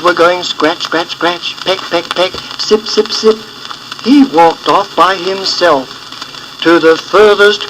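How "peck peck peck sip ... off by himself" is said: plain reading voice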